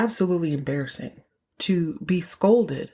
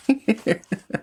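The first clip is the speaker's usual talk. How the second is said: short amused laugh; 4 semitones higher than usual. Real, not acted. Host finishing her point about marriage, amused laugh. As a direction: break off into a laugh.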